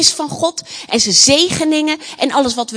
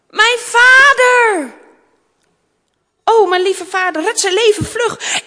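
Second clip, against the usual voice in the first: high, loud wail for father; desperate cry; 8 semitones higher, 8 dB louder than usual. Acted. Princess fears for her buried father. (wanhopig om vader roepen)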